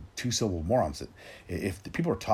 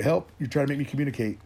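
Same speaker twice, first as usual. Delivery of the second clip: mock panic; 7 semitones higher, 5 dB louder than usual. Mocking the panicked phone user.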